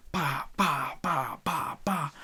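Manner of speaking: rapid-fire "bah" for each punch; punchy vocal sound effect, evenly repeated; imitated punch barrage. Showing fans the Gum Gum Gatling, imitated punch noises.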